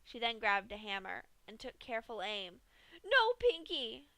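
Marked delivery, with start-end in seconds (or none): 3.0-4.1 high, loud, drawn-out plea; terrified scream. Acted. Dash begs Pinkie not to hammer, high scream. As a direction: beg her to stop, slowly and loud. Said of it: "Dash screamed".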